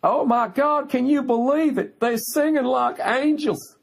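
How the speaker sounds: high swooning gush, slow at the end; shrill parody of a fan in awe; mocking gushing fan. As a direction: ham up a starstruck theatregoer. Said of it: "the looks on their faces".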